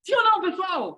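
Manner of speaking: high, insistent call to the room; rallying the audience. Shown as a demonstration. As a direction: call it out to get a stronger answer. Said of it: "Aí você pode pedir de novo".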